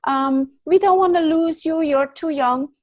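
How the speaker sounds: hammed-up tearful family voices; mock crying relatives. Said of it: "everybody crying".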